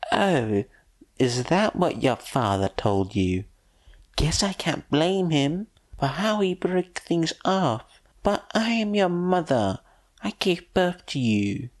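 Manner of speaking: high, irritated woman's voice. Acted. The mother scoffing at Egon's father, high and irritated. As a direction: scoff and insist, pitched high.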